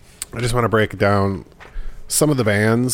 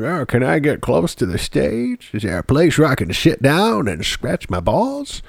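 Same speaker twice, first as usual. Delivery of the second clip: put-on creaky old man, pitched up; 6 semitones higher than usual. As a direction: play a tired old geezer. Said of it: "you wanna be like".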